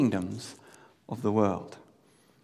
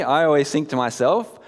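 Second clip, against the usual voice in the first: ordinary talk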